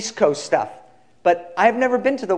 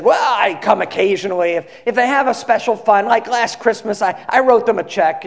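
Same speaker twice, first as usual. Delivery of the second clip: higher-pitched imitation of a passenger making excuses; hedging seatmate; 5 semitones higher, 4 dB louder than usual. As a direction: play someone justifying himself, pitched up. Seatmate hedges about attending church.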